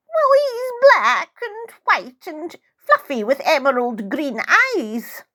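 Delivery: put-on high, fond old lady; old woman. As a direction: describe him high and fondly like a frail old woman. Old woman describing cat.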